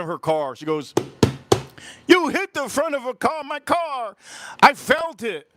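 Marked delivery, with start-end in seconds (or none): 2.1-5.5 high-pitched mock screaming; hysterical accusation. Woman hitting her own car to accuse him, high mock scream. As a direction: imitate an outraged driver, pitch way up. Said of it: "she started screaming".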